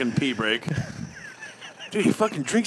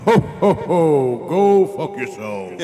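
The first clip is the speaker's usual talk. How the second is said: loud gruff Santa cursing; rude Santa; 5 dB louder, much slower than usual. Retold or imitated. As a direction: jolly laugh turned nasty. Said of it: "imagine if he was like".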